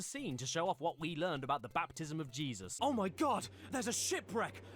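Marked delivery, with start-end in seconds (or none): none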